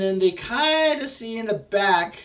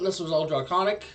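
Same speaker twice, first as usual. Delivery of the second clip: ordinary delivery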